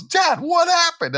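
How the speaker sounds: put-on very high, slow kid voice; imitating son. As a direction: play the confused young son. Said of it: "my son goes".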